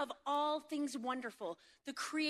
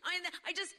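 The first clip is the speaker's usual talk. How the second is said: choked up; 5 semitones higher than usual. Retold or imitated. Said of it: "somebody's really upset".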